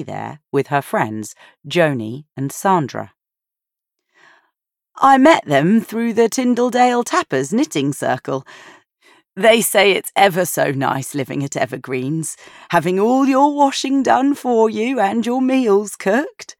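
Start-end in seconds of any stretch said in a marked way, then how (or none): 5.0-16.6 put-on higher, lighter old lady chatter; chatty grandmother, a bit louder; Granny's voice. A narrator's reading. Granny telling Maddie about the care home, higher and chatty. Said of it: "Granny had told Maddie".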